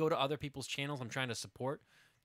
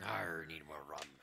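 slow, whispered pirate growl; 9 semitones lower, 8 dB quieter than usual. Pretend. Repeats the pirate line as a pirate.

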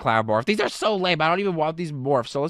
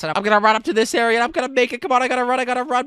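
higher, breathless-style rush of self-urging; urgent hype; 6 semitones higher, 3 dB louder, faster than usual. Pretend. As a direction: urge yourself on in a high, excited voice. Hurrying past the bowling ball.

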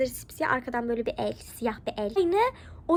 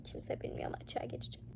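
a hushed confession, barely voiced; secret whisper; 16 dB quieter than usual. Real, not acted. Confessing a secret to viewers, whispered. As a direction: whisper it so nobody else hears.

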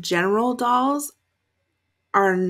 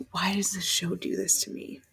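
whispered complaint; 7 dB quieter than usual. Real, not acted. Viewer whispering to the show, in dread. Whispered plea about a scary doll.

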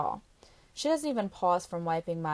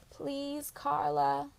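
pleading; 7 semitones higher, much slower than usual. Acted. Girl begging Carla for a visit, higher. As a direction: plead with her.

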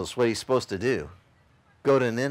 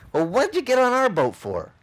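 sailors' challenge; 11 semitones higher, 5 dB louder than usual. Retold or imitated. Sailors confronting Jonah on boat.

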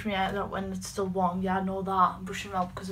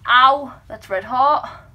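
loud yelp, then slow complaint; pained yelp; 2 semitones higher, 11 dB louder, much slower than usual. Real, not acted. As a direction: yelp at the heat. Touching hot curler.

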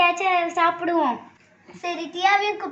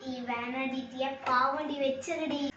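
softer, slower begging; hushed pleading; 4 semitones lower, 9 dB quieter than usual. Acted. Sister pleading softly to leave Diya's biscuit. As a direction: beg quietly, don't let her be hurt.

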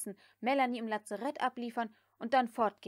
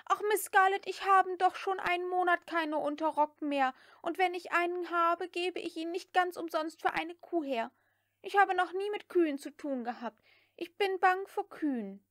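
high, pleading maid's voice; whining maid Prissy; 8 semitones higher, 5 dB louder than usual. A narrator's reading. Prissy pleads with Scarlett, high and whiny. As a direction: plead your way out of it.

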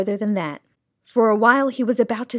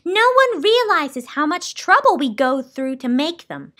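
put-on higher-pitched, aggrieved old hand; complaining; 6 semitones higher than usual. A narrator's reading. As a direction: grumble about unappreciated work. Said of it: "she complained".

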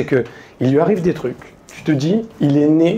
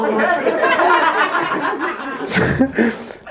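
laughing; 13 semitones higher than usual. Real, not acted. Comedian cracks up telling story.

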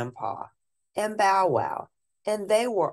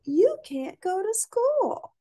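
much higher, slow, gentle scolding; high child voice; slower than usual. Acted. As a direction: explain it to the dog like a kid. Child tells the dog.

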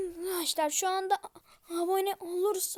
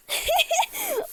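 shrieking scared laugh; 12 semitones higher, 5 dB louder than usual. Real, not acted. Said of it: "Çok korktum".

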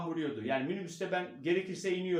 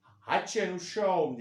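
slow, louder Karadeniz catchphrase; 6 dB louder, slower than usual. Shown as a demonstration. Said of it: "diye geçiyorum".

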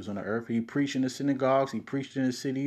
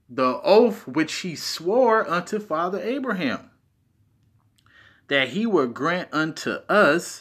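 higher, louder, slow on "oath"; emphatic reading; 5 semitones higher, 5 dB louder than usual. Shown as a demonstration. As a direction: weigh down on "oath". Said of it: "if you put emphasis on certain words".

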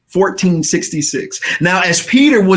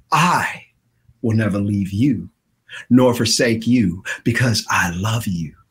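much lower, soft, gentle; Jesus comforting the listener; tender promise; 10 semitones lower, 6 dB quieter than usual. Acted. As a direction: reassure quietly.

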